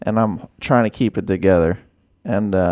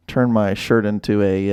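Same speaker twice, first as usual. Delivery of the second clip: ordinary talk, nothing marked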